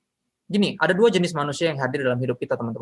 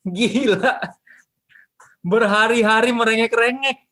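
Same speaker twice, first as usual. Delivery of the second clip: high, loud and slow, hammed up like a TV drama; soap-opera drama; 9 semitones higher, 4 dB louder, slower than usual. Shown as a demonstration. Preacher dramatizing Delilah's nagging, hammed-up melodrama. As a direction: overplay it like a cheesy soap. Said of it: "gua coba dramatisir, biar kayak F T V".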